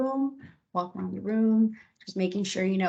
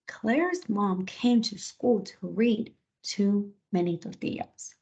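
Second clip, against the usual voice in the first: slow dictation; slower than usual. Shown as a demonstration. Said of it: "I'll say".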